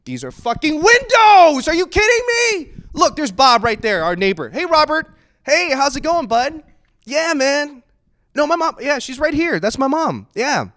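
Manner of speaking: high, loud and frantic; loud forced cheer to the neighbor; loud embarrassed ranting. Retold or imitated. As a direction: shout it all through the car window. Yelling, then greeting a neighbor.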